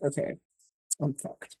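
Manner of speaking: soft, defeated under-the-breath realization; resigned mutter. Retold or imitated. The trapped vampire realizing she's stuck, muttering softly. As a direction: drop it quiet as if caught.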